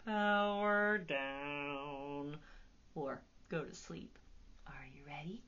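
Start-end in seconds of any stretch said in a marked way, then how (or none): 0.0-3.2 slow, sinking, lightly robotic; robot powering down. Shown as a demonstration. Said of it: "robots are going to power down". 4.7-5.4 soft whispered question. Real, not acted. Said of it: "whispered cue to children".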